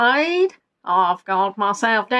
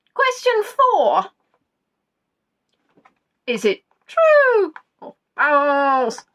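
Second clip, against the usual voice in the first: quiz host; 5 semitones higher, slower than usual. Acted. Quiz host reading the question.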